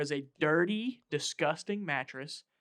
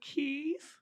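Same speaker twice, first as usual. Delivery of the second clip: much higher, rising call of Keith; 13 semitones higher than usual. Retold or imitated. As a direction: call his name nervously up the stairs. Calling out for Keith.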